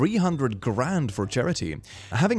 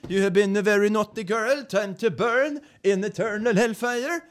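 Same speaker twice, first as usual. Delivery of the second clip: mock Swedish devil, higher and gentle; Swedish accent; 11 semitones higher than usual. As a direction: play a harmless Swedish devil. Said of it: "not very intimidating when you say it like that".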